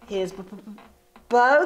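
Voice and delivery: startled stutter on the b; stammering surprise. Acted. Sisters caught out by the question, stammering. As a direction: stumble over the word in shock. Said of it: "they stammered in surprise".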